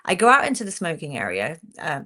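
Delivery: usual speaking voice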